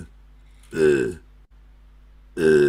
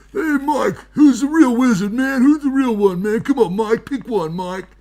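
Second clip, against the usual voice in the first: high pleading wizard; 6 semitones higher, 6 dB louder than usual. Acted. Wizard character pleading with Mike.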